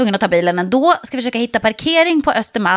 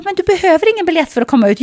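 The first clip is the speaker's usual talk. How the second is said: put-on old man explaining; 7 semitones higher, 3 dB louder, faster than usual.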